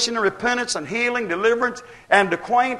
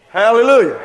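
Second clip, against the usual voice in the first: shouted hallelujah; 2 semitones higher, 6 dB louder than usual. Real, not acted. Preacher's exclamation.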